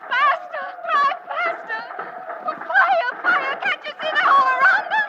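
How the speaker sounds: high, frantic screams for speed; panicked shouting. Acted. Dallas crying out to the driver in panic, high frantic shouting. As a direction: scream it in terror.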